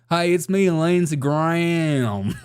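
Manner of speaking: slow, drawn-out Southern senator voice; exaggerated Southern drawl, final words dragged out; Lindsey Graham impression. Shown as a demonstration. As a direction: stretch the vowels like a hammed-up Southern politician. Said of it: "Lindsey Graham sounds like this".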